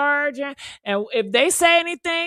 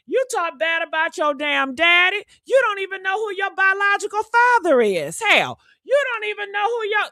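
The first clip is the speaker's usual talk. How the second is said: furious shout; 4 semitones higher than usual. Real, not acted. Host yelling at the women who mock her, furious. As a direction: tear into her. Cussing out fake queens.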